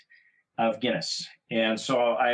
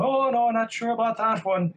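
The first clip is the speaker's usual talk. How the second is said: higher, friendly local's voice; Irish pub guy; 10 semitones higher than usual. Retold or imitated. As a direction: play the curious stranger at the bar. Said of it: "retold pub conversation".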